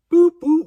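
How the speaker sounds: high, loud beeping voice; high beep imitation. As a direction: mimic phone keypad beeps. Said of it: "imitating phone beeps".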